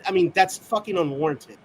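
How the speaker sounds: ordinary talk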